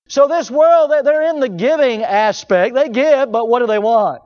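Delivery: rising pulpit fervor; full-force preaching. Acted. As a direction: preach it out to the back pews. Preacher challenging the congregation.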